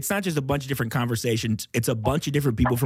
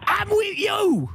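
very high, drawn-out shout; different voice; much slower than usual. Acted. Heckler answering the usher, high shouted put-on voice. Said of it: "it's a different voice".

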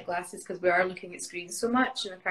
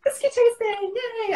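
high, loud delighted squeal of Whiskey tasting, yay; excited cheer; 14 semitones higher, 7 dB louder than usual. Real, not acted. Guest hearing about whiskey tasting at the gala, squealing with delight. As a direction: cheer it like the best news all day.

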